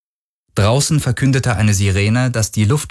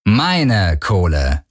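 "Meine" drawn out slowly; slow, weighted "Meine"; stress on Meine; much slower than usual. Acted. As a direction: stretch "Meine" to claim it as yours. Captain corrects him, stressing meine.

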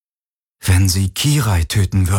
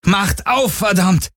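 furious demand; 10 semitones higher, slower than usual. A narrator's reading. Shouting at locked door.

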